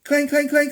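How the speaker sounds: high loud ringing clang; bell clanging. Acted. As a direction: ring it out like a bell. Imitated dinner bell.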